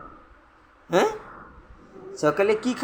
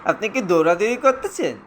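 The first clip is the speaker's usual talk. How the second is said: ordinary delivery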